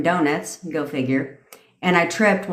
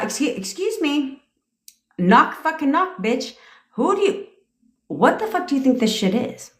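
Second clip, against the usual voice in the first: demo of angry attitude; 7 semitones higher than usual. Shown as a demonstration. Coach demonstrates an angry confrontation with a partner, high mock outrage. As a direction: cut in sharply, swearing at them. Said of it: "that type of attitude".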